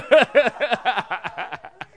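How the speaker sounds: high loud burst of laughter. Real, not acted. Teacher laughing after her angry demo. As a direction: break into laughter.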